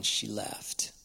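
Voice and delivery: whispered aside. Real, not acted. Quiet aside in a sad story.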